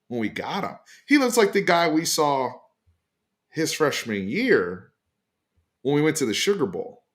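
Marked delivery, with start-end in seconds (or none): none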